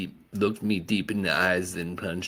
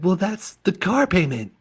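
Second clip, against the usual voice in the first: mom voice; 11 semitones higher, 6 dB louder than usual. Acted.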